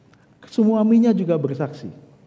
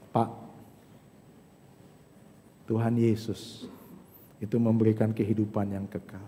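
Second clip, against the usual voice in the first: hushed sincere appeal; 6 semitones lower, 5 dB quieter than usual. Acted. Pastor gently telling the congregation of eternal life, hushed and sincere. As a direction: draw them in with a near hush.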